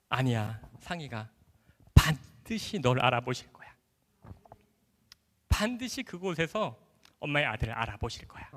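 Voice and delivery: soft, low, slowed-down tender assurance; reassuring mother. Retold or imitated. Mother assuring her son he will be recognized, soft and slow. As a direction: comfort a child with quiet conviction.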